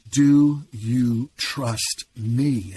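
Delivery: very slow, drawn-out repeat of the question; insistent voice of God. Retold or imitated. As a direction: press the question again, unhurried and firm. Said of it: "And then I heard again".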